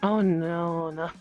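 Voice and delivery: normal talking voice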